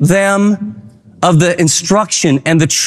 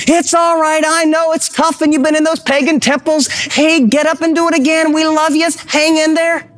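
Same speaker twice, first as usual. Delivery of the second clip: high-pitched, urgent cheerleading dad; cheering dad; 8 semitones higher than usual. Acted. Dad urging a fallen son back up, encouraging. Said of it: "We were the dad who said".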